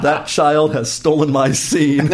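slow, loud, hammed-up outrage; mock indignation. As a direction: declaim it as a wronged actor, each word stretched. Mock outrage at his daughter.

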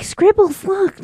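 put-on high, excited kid calling. Kids calling the cat, high and loud. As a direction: call the cat in a squeaky child voice. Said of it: "They're just like".

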